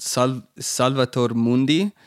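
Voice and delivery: slow, careful Italian "Salvator Mundi"; Italian pronunciation. Shown as a demonstration. Podcast host says the painting name, mild Italian accent. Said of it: "I don't know how to do that in an American accent".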